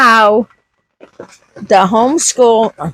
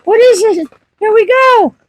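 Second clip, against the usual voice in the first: imitating an inner groan that climbs much higher; exasperated; 8 semitones higher than usual. Retelling her reaction to a friend's conspiracy talk, exasperated. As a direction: here we go again, spoken up high in mock dread. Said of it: "And I'm like".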